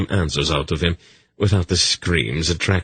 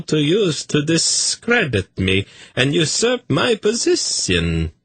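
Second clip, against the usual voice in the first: eunuch Tzadi; 10 semitones higher than usual. A narrator's reading. Tzadi telling story.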